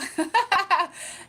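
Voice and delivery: loud, high laugh. Real, not acted. Streamer laughing at a chat comment, loud laugh.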